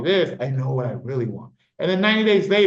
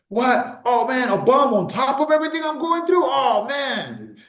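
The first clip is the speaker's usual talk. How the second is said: much higher, panicky mock wail; mock panicked; 9 semitones higher, 3 dB louder than usual. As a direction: play a stressed person overreacting in fake panic. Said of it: "But if you're stressed".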